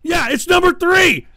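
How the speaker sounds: shouted high and loud; furious yell at a chat troll; angry shout. Real, not acted. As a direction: yell it at the viewer in exasperation. Yelling at a viewer in chat.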